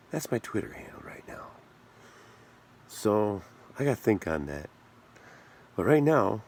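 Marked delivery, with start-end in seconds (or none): 0.1-1.5 breathy aside whisper under his breath; confiding whisper to the viewers. Real, not acted. Whispering an aside to viewers about his handle.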